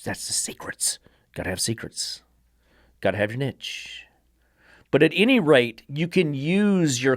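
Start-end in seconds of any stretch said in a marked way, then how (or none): 0.0-4.0 secretive whisper. Real, not acted. Sly aside about his trade secrets.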